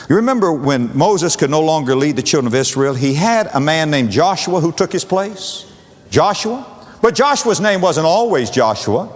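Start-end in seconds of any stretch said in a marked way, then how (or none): none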